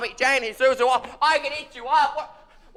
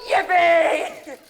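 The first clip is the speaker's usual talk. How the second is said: loud, high yell of delight; joyful whoop; 3 semitones higher, 3 dB louder than usual. Acted. Man back in his life whoops for joy.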